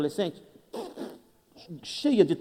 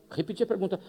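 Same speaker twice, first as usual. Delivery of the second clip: usual speaking voice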